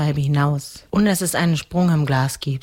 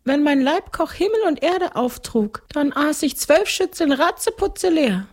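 very high ghost-count voice; gluttonous ghost count; 13 semitones higher than usual. Acted. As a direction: recount it as a high-pitched ghost nobleman. (hohe Stimme eines Gespenstergrafen)

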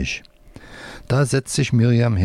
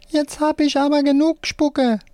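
screeching hand puppet. A narrator's reading. Drenched left hand has had enough, high squealing puppet voice. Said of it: "seine linke Hand schreit".

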